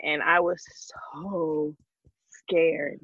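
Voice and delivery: unvoiced, hushed telling; whispered fear. Real, not acted. Mother recalls being pulled over, hushed whisper. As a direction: drop to a whisper. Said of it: "retelling her fear".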